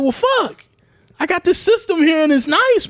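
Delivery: high exasperated groan-talk; exasperated resignation. Retold or imitated. Complaining there's nothing to play, high exasperated. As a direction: sigh it out in mock despair.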